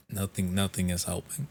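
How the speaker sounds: ordinary talk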